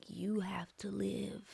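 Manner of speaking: voiceless whisper; whispered advice. Retold or imitated. Director whispering advice to his actress. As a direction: breathe the words like a secret. Tyler's advice after scene.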